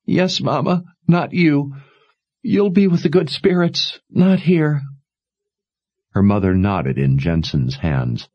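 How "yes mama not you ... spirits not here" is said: high, tearful agreement; high tearful yielding; tearful daughter. Acted. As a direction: give in through tears.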